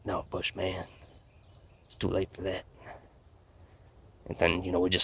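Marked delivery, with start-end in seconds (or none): 0.1-2.6 much softer, dead serious; grim quiet refusal. Retold or imitated. Alien refuses Bush's plea, softly and ominously. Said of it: "And he's just like".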